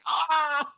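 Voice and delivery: short high yelp of shock; mock shout. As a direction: blurt a sudden high cry. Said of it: "and he's like".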